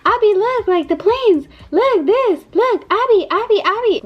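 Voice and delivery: very high, insistent toddler impression; loud excited child. Mom imitating her son calling sister to see planes, excited. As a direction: imitate a little kid pestering excitedly. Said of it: "He was being loud. Like, he was like".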